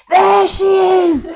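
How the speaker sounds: a slow, high, loud call across the crowd; joyful shout. Retold or imitated. Friend Jackie spotting her near the finish, a joyful high shout. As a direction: shout it out as you spot her. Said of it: "I just heard this voice go".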